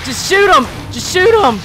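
high, loud frantic yell; frantic shout. Real, not acted. Player yelling at Jill to shoot, frantic and loud. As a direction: yell at her to fire. Urging character to fire.

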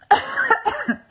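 sudden hard cough; real coughing fit from a choke. Real, not acted. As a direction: cough on a crumb stuck in the throat. Choking on pistachio salad.

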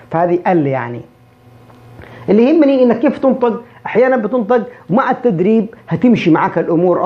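ordinary delivery

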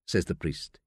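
ordinary delivery

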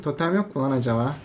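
ordinary talk, nothing marked

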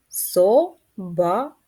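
"собака" slowly as "со-ба-ка"; split into syllables. Shown as a demonstration. Teacher counts syllables in "собака" aloud. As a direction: drag "собака" out one syllable at a time. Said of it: "Три склади".

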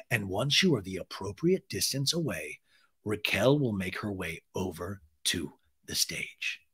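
measured, ominous instructions to clear the stage; mock-solemn host. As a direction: drop low and draw out the ending. Acts host solemnly ordering her off.

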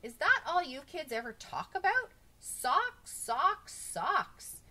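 loud, high outburst; exasperated shouting. A narrator's reading. Bob scolding kids for talking about socks, exasperated shout. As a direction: rail at them, punching each repeated word. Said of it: "Bob shouted so loud it scared everybody".

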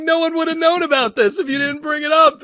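very high and loud disbelief; high exasperated rant. Retold or imitated. Host retelling his reply to Booker, high and loud. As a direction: rant it up high, half laughing. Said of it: "I'm like".

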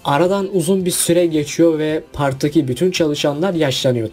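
normal talking voice

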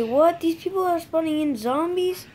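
usual speaking voice

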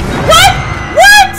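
very high, very loud startled shrieks; shocked shriek. Real, not acted. Jolted by a monster popping up, shrieking. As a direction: shriek in sudden fright. Sudden scare in horror game.

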